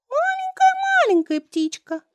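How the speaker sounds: very high, squeaky tiny voice; thin little voice. Acted. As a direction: pretend to be a tiny bird. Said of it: "тоненьким голоском отвечает мальчик".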